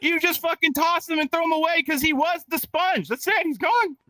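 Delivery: high-pitched comic indignation; mock outraged rant. Comic dismissing the used-up cartoon sponge, mock outraged.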